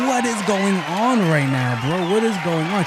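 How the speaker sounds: loud, exasperated retelling; bewildered outburst. Retold or imitated. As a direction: demand an answer over the uproar. Bewildered outburst while everyone laughs.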